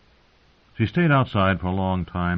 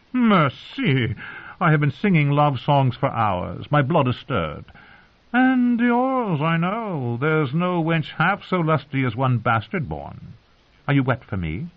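put-on high, theatrical drunken wooing; lustful singer; 8 semitones higher than usual. A narrator's reading. Drunken singer pressing himself on the girl, high lustful wooing. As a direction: plead high and florid, easing down slightly.

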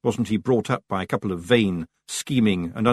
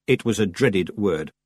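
ordinary delivery

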